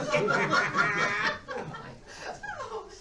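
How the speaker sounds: high loud burst of laughter; big laugh. Real, not acted. Laughing at the nasty note.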